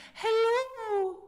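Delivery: very high, loud head voice; high ringing hello. Shown as a demonstration. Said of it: "So let's try it again".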